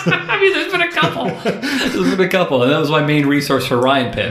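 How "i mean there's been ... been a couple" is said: talking through laughter, pitched high; laughing. Real, not acted. Admitting to co-host there were several, laughing. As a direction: chuckle your way through it.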